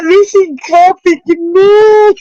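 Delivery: very high, drawn-out despairing dad; exasperated dad. Retold or imitated. Streamer voicing the dad in the video, slow despairing wail. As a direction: drag it out like a dad at his wits' end. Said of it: "the dad in crowd is like".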